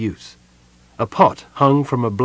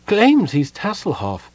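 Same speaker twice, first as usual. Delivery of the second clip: higher, slow, drifting through a door; from another room; 10 semitones higher, slower than usual. A narrator's reading. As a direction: sceptical voice calling from offstage. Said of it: "Usher's voice came floating through the door".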